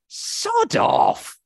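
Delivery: sudden high breathy shout, half comic; mock outburst. Host venting at the manager's farewell statement, mock shout. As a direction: blurt it out like an exasperated fan. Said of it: "I just thought".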